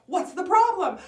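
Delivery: high, loud, urgent question; rescuer's shout. Acted. Hunter rushes in to save the girl, urgent shout. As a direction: call out as you rush in.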